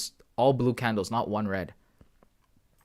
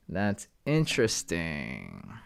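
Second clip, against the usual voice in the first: slow and low, softer; slow, low musing; 6 semitones lower, 5 dB quieter, much slower than usual. Real, not acted. Streamer pondering a chart, slow low murmur. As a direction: draw it out slowly, lower and softer. Muses on chart, says it twice.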